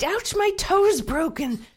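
high, loud wailing cries for help; hammed-up howl over a stubbed toe; shrieking in pain. A narrator's reading. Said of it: "he shrieked".

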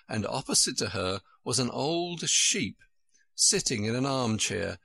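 plain reading voice

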